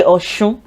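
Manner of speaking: Ọ̀ṣun said with sh, "aw-shoon"; pronounced with SH. Shown as a demonstration. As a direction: soften the s of Ọ̀ṣun to sh. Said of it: "people say Ọ̀ṣun".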